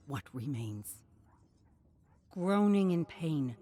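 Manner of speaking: ordinary delivery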